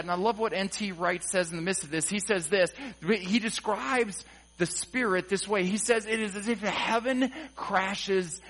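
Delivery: normal talking voice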